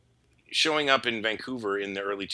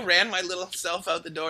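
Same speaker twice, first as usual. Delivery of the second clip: ordinary delivery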